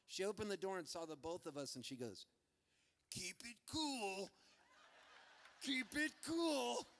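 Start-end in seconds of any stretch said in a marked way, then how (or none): 3.1-4.3 slow, hushed mom voice, drawn out; mock-cool mom, soft and stretched; mom playing it cool. Mom greeting at the door. 5.6-6.9 slow, high, strained mom voice; mom insisting on cool. Acted. Mom repeats it, more forced.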